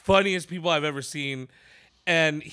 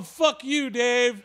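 high-pitched mock yell to the back; retold yell; 7 semitones higher, much slower than usual. Comic leads crowd against promoter, mock yell. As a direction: imitate calling across a theater, not full force. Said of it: "yell it to the back of the theater".

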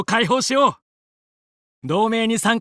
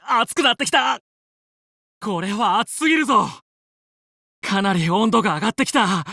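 ordinary talk, nothing marked

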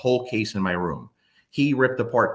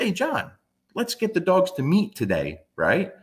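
upbeat call; 9 semitones higher than usual. Retold or imitated. Calling buddy John.

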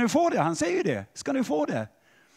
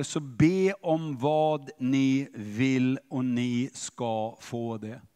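very slow, word by word, pitch sinking; slow recital; 7 semitones lower, much slower than usual. Acted. Preacher quoting Jesus's promise to the congregation, slow and measured. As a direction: let each word stand apart, deliberately.

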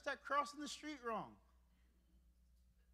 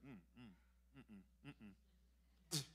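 humming tasting words; 12 semitones lower, 16 dB quieter, much faster than usual. Retold or imitated. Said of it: "taste your words when you're getting angry".

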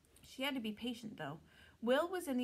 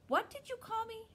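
indignant; 13 semitones higher, 4 dB louder than usual. Acted. Sophie insulted by unseen voice.

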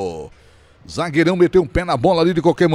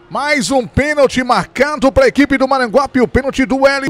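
excited; 6 semitones higher, 4 dB louder than usual. Real, not acted. Penalty awarded, live call.

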